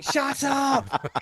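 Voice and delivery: high, put-on snap of irritation; mock exasperation. Driver answering Lawrence's directions, mock fed-up snap. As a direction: fake fed-up retort to him. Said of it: "And you're going".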